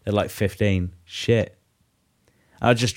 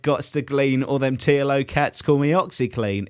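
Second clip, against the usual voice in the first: ordinary talk, nothing marked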